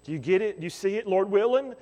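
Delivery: ordinary talk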